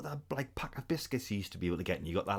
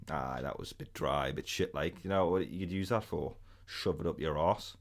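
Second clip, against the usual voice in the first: low, slow, softer deadpan dismissal; unimpressed verdict; 7 semitones lower, 4 dB quieter, slower than usual. Acted. Friend mimicking someone disappointed by a pink wafer, unimpressed. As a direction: drag it out, thoroughly underwhelmed. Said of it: "you eat it and you go".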